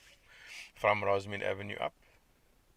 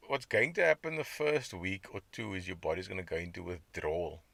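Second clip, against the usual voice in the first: ordinary talk, nothing marked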